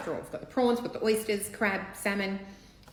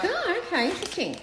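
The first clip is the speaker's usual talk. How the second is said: mock surprise; 13 semitones higher, 3 dB louder than usual. Mock reaction of viewers.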